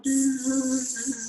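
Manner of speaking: long, steady buzz on one breath; buzzing bee sound. Shown as a demonstration. Teacher leads breathing exercise, long bee buzz. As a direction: let a z buzz until the breath fades. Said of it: "make that buzzing bee sound out".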